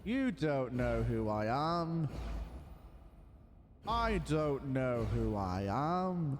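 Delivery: mock grand pronouncement; slow dramatic declaration. Player hamming up an identity crisis, very slowly. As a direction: draw each word out, slow and heavy.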